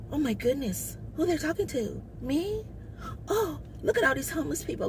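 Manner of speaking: high, soft, snooty voice in mock shock; mocking his fake shock. Mocking a bougie homeless man, high soft mock dismay. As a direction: play him as a man acting above it all, mock-horrified and high. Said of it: "And he looking like".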